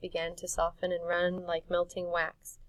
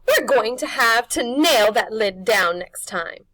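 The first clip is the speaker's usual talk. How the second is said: slow, loud, higher crone menace; witch voice; 4 semitones higher, 15 dB louder than usual. Acted. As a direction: threaten with relish. Witch threatens.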